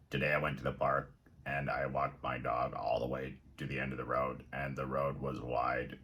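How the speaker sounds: dull droning monotone reader; bored flat reading. Shown as a demonstration. Teacher mocking a student who reads flatly, bored drone. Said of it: "Do you read like this when you read?".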